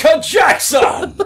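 high, loud blurt of the name; quick-fire shout; shouted answer. Acted. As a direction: yell it like a game-show answer.

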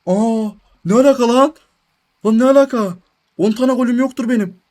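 high, loud incredulous outburst; can't believe the award is yours; shocked disbelief. Real, not acted. Gamer reacting to a surprise season award in disbelief.

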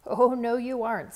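loud, sharp "Oh, no" cutting him off; firm refusal. A narrator's reading. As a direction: shut the idea down at once. Mom stops Dad going out.

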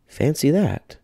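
put-on high, slow, amazed old lady; little old lady, marvelling. A narrator's reading. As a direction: savour the wonder of it. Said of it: "said the little old lady".

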